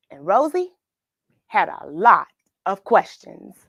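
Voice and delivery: story reading. Acted. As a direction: read it like storytime, unhurried. Reads picture book aloud.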